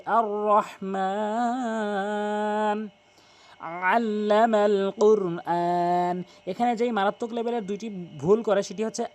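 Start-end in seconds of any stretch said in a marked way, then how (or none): 0.1-6.2 slow drawn-out আর রহমান, long vowels held; tajweed recitation. Shown as a demonstration. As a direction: stretch আল্লামাল কুরআন with care. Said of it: "তাহলে আপনার তিন আলিফ টেনে পড়তে হবে".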